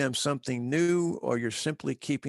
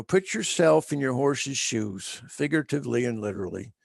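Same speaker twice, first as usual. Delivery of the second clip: ordinary talk, nothing marked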